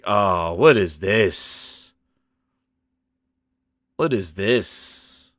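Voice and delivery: very slow, low groaned question; dismayed disbelief. Retold or imitated. Viewer dismayed by the costume, slow low disbelief. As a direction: drag it out low, as if dumbfounded. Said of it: "I was just like".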